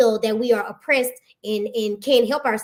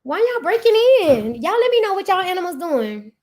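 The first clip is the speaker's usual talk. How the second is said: exasperated shout; 7 semitones higher, 4 dB louder than usual. Retold or imitated. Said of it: "And it's like".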